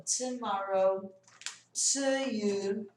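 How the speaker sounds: "tomorrow" and "to you" with crisp T, no CH; same clean T. Shown as a demonstration. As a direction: begin "tomorrow" and "to you" as "tuh". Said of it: "E aí vai valer pra palavras como".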